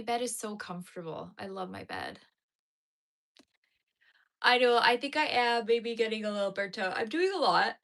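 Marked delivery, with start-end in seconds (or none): 4.4-7.0 louder, stretched words mid-yawn; talking through a yawn. Real, not acted. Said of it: "I'm yawning so much". 7.0-7.8 "I'm doing a lot" yawned out, louder and stretched; yawning. Real, not acted. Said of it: "I'm yawning so much".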